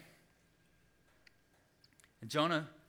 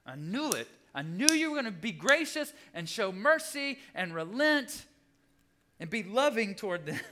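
high, loud acted outburst; mock-outraged I-told-you-so; indignant complaint; 9 semitones higher, 7 dB louder than usual. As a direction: throw it at God like an accusation. Acting out Jonah's complaint to God.

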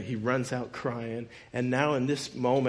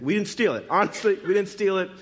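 retold anxious denial, pitched up; nervous protest; 6 semitones higher, 3 dB louder than usual. Brothers found with the money, nervous protest. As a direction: protest innocence in a higher, mildly nervous voice. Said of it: "they're nervous".

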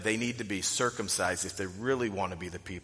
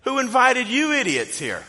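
much higher and louder, shouted mid-sermon; brash outburst; 14 semitones higher, 10 dB louder than usual. Retold or imitated. Preacher to an antagonistic group walking in, loud brash shout. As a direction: stop the sermon and yell at the latecomers. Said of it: "He stops mid-sermon. He's like".

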